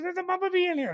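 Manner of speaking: high, alarmed announcement; mock alarm. Husband imitating his wife spotting the bee, high alarmed voice. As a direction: squeak out the warning. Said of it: "He goes".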